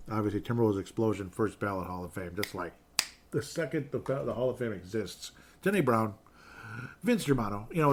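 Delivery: ordinary delivery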